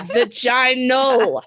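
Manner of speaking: Vaginal stretched out as va-JY-nal; very slow Vaginal, lingering on the "jy"; drawn-out pronunciation demo. Shown as a demonstration. Host teaching co-hosts the word, Vaginal drawn out as va-JY-nal. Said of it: "don't say vagina and vaginal properly".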